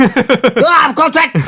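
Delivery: high, drawn-out mock yell; hammed-up outraged shout, very high. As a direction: imitate an irate customer shouting. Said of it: "She started shouting".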